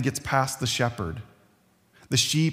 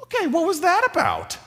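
much higher, squeaky bleating sheep; 4 dB louder than usual. Retold or imitated. Sheep complaining to the shepherd, high squeaky bleat. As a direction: play an indignant little sheep. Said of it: "No sheep can say to him".

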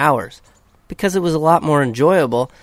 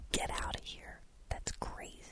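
whispering; 22 dB quieter than usual. Pretend. Mock whisper to listeners.

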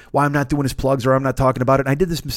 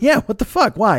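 baffled; 9 semitones higher, 4 dB louder than usual. Real, not acted. Asked if he's safe, baffled.

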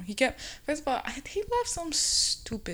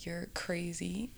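slow hushed retort; 3 semitones lower, 10 dB quieter, slower than usual. Retold or imitated. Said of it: "I was like".

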